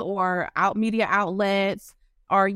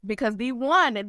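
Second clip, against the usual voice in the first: usual speaking voice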